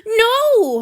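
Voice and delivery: high, loud horrified No; horrified gasp. A narrator's reading. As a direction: blurt it out in horror. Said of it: "Han Yu gasped, horrified".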